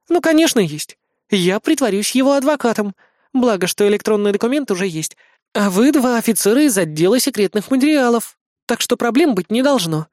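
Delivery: put-on high, girlish explaining; confident idol girl. A narrator's reading. Idol girl briefing the captain, confident and girlish. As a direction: lay out the plan in a high voice.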